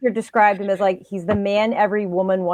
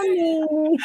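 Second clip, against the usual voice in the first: high, softer drawn "Coming"; Frank-N-Furter impression; 9 semitones higher, 4 dB quieter than usual. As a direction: coo "Coming" toward the door. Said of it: "I'm like".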